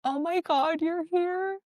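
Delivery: high-pitched mock tearful cry; retold bawling. Retelling seeing her best friend there, mock bawling. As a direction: imitate happy bawling in a high voice. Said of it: "I literally start bawling".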